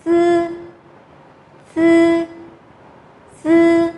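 "Sư" as a long, high level unaspirated z; tongue-straight Z sound. Shown as a demonstration. Teacher models first initial for students, "sư" as long level z. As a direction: hold each "sư" high and steady, tongue straight behind the upper teeth. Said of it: "Chúng ta sẽ phát âm âm này lại".

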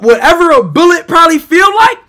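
ordinary talk, nothing marked

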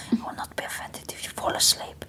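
imitated Spanish masseuse whisper; quick, very soft whisper in a Spanish accent; hushed Spanish accent. Spanish masseuse settling a client, hushed accented whisper. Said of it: "like, this beautiful accent".

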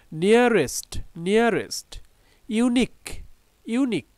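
isolated "নিয়ারেস্ট" and "ইউনিক", each repeated with falling tune; word-list reading of "নিয়ারেস্ট, ইউনিক", pausing between; pronunciation drill. Shown as a demonstration. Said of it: "উচ্চারণগুলো জেনে নেই".

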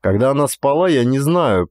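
ordinary delivery, nothing marked

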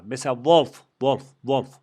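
"wolf" said as volf, with a hard v and a clear final f; model pronunciation. Shown as a demonstration. Teacher drilling the class on "wolf" pronounced volf. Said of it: "W O L F diye geçiyor".

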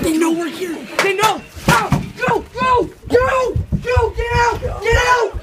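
high, loud panicked yell; panicked shouting. Pretend. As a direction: shout at them to run. Caught, fleeing the house.

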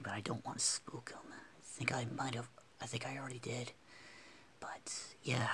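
soft whisper to avoid spooking him. Real, not acted. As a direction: keep it a hushed whisper. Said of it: "sorry for whispering".

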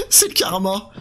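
laughing it off. Real, not acted. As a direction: laugh through the words. Laughs at glitch.

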